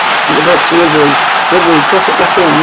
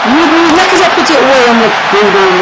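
high, thrilled goal call; excited goal call; 8 semitones higher than usual. Real, not acted. Goal scored while playing.

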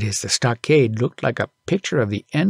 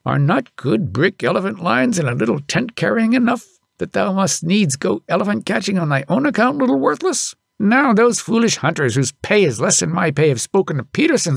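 a lightly raised scolding father; father's scolding; 7 semitones higher than usual. A narrator's reading. Father scolding his son the next morning, lightly voiced. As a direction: scold the boy, a touch higher. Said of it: "gave him a scolding".

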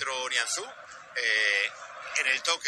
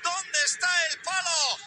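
shouted goal commentary; 13 semitones higher than usual. Real, not acted. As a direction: shout the scorer's praise to the crowd.

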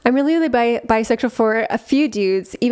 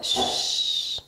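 talking to dog; 9 semitones higher, much slower than usual. Real, not acted. Soothing the barking dog.

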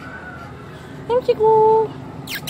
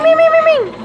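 high calling out; 8 semitones higher, faster than usual. Real, not acted. Calling the dog to come.

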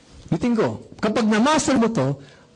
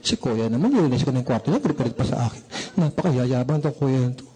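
low-pitched, muttered complaint; grumbling nanny; 7 semitones lower, faster than usual. Acted. Nanny complaining about the boy after cleaning his room, grumbling. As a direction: grumble low as the put-upon helper. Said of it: "Sabi ng yaya".

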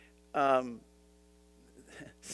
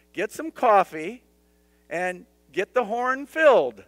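normal talking voice

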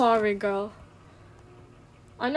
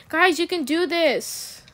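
loud, slow cheer for the team; rallying shout; 11 dB louder, slower than usual. Real, not acted. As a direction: cheer your teammates on loudly. Cheering on teammates.

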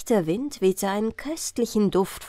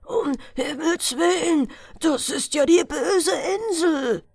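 much higher, alarmed exclamation; terrified cry; 10 semitones higher than usual. A narrator's reading. Old fisherman recognizing the evil island, terrified cry. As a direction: cry out pale with fright. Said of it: "rief er plötzlich ganz bleich vor Schrecken".

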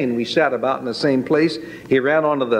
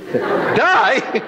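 high, laughing disbelief; startled question; 8 semitones higher than usual. Retold or imitated. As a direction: squeak it out, half laughing in shock. Shocked at baptism explanation.

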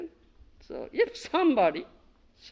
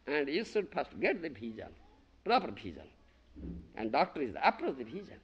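ordinary delivery

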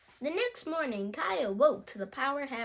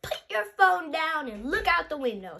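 raised, louder motherly order; yelling; 4 semitones higher, 7 dB louder than usual. Acted. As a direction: call out an insistent instruction. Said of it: "Mom yells".

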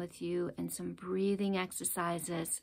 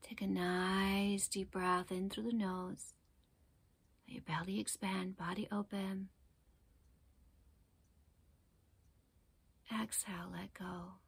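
guided meditation; 8 dB quieter than usual. Acted. Teacher guiding listeners to focus on the breath, calm meditation voice. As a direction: ease them gently into their breath, unhurried.

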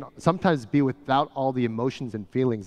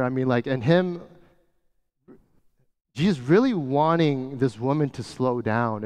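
ordinary delivery

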